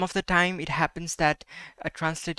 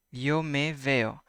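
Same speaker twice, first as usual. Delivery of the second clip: slow; 2 semitones lower, slower than usual. Shown as a demonstration. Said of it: "teacher repeats sentence slowly".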